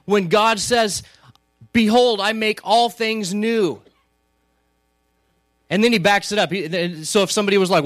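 loud, driving sermon voice; full-force preaching. Acted. Pastor stirring the congregation about God's promise, loud and driving. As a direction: preach it to the back row.

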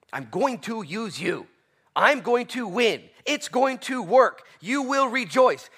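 God promising Gideon victory, building loud; rising proclamation. Acted. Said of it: "The same thing".

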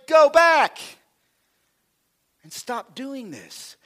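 God pleading with Jacob; God speaking. Pretend. Continuing God's voice.

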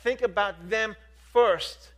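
loud, driving pulpit preaching; preaching at full force. Acted. Pastor preaching loudly to his church about total commitment. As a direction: press every line home to the congregation.

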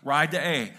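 loud call. Shown as a demonstration. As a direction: shout the tip "Ride the A." to the bass players. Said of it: "Any bass players here?".

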